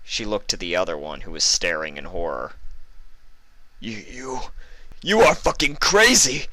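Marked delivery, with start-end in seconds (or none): 3.8-6.5 terrified buffalo, stammering; panicked accusation; horrified cry. A narrator's reading. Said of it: "he cried".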